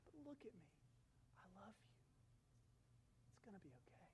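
soft whisper of comfort. Acted. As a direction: whisper it to a child in pain. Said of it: "and he says".

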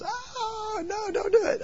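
put-on very high, whining plea of a young girl; pleading girl. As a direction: beg him not to tell, half crying. Girl begs pastor not to tell.

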